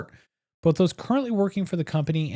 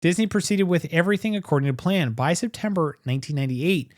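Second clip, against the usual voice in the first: usual speaking voice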